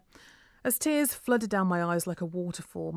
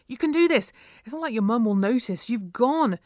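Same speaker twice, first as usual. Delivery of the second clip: louder, insistent pep talk; urging on; 4 semitones higher, 7 dB louder than usual. A narrator's reading. Leah's guts telling her to go, urging. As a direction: push her to be brave. Gut feeling urges her on.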